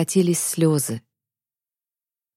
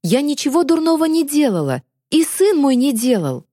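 high-pitched protest of innocence; distressed cry; 8 semitones higher than usual. A narrator's reading. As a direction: plead desperately while being dragged off. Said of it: "задыхаясь, выкрикнула она". (отчаянно выкрикнуть, задыхаясь)

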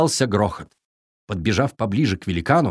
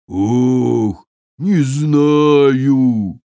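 sad giant; 5 dB louder, much slower than usual. A narrator's reading. Giant upset.

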